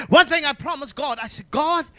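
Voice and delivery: fervent, driving pulpit testimony; impassioned gospel preacher in full flow; full-force preaching. Real, not acted. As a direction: preach in punched phrases, building toward shouts.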